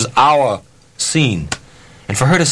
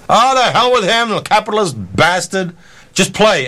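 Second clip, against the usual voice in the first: mock heckler; 8 semitones higher than usual. Audience member heckling, retold.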